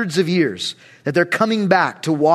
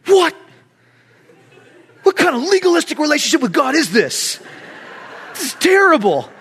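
mock indignation; 8 semitones higher than usual. Caught eating during a fast, mock indignant protest. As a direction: play up outraged disbelief. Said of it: "and then I'm angry".

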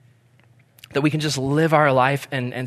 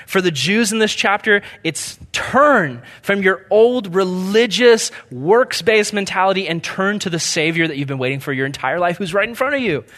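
ordinary delivery

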